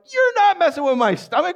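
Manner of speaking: high-pitched mock indignation; defensive wise cat. We wise cats guarding our midsections, high indignant protest. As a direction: push back in a very high, protesting voice. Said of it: "And so we are wise cats".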